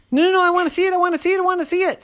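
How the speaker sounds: high, fast pleading squeak; high fast begging; insistent nosy squirrel. Acted. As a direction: rattle it off eagerly. Squirrel insists to see nut.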